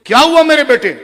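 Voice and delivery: a mother's panicked question; startled, worried mother to her son; anguished mother. Retold or imitated. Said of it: "تڑپ گئی".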